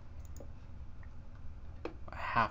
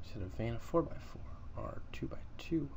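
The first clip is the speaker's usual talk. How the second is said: whispered muttering; 7 dB quieter than usual. Real, not acted. Muttered to self.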